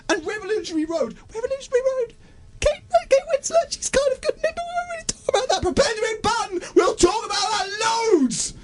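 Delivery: extremely high, strangled-sounding rant; squealing exasperation. Acted. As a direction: let the outrage climb into a squeak.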